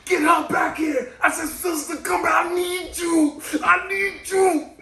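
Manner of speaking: high, drawn-out pleading yell; retold yelling. Patient yells to the nurse as the door shuts, pleading. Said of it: "I started yelling at the sister".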